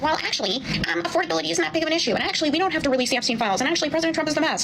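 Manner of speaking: high, fast, whiny mock voice; high, rushed, mocking take on a flustered official; mocking impression. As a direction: rattle it off like a squirming politician. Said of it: "he's like".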